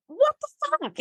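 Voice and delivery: mock outraged "What the fuck?"; stunned outrage. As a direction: let the disbelief jump up high. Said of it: "she kinda loses it".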